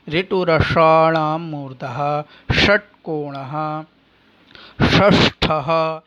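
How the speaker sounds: slow, deliberate chant of ऋतुरसाणाम मूर्धा; sutra recitation. Shown as a demonstration. Teacher reciting the Sanskrit rule slowly for students. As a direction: lay out each syllable of the sutra slowly.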